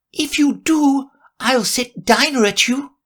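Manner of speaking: a threat called up the chimney; mock loud call. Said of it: "called out as loudly as she could".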